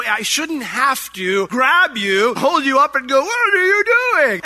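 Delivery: strained start rising to high yell; slow, drawn-out build to an exasperated shout; building to a scream. Acted. Exasperated parent to misbehaving kids, building to a scream. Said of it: "have to scream at us".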